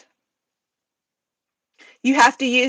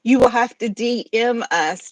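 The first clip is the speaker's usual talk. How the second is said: usual speaking voice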